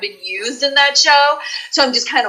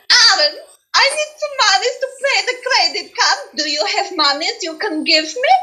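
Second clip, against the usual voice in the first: put-on high, cheerful Polish accent, breezily broke; Polish accent on the phone; 8 semitones higher than usual. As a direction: sweetly plead poverty to a bill collector in a Polish accent. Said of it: "she talked like this".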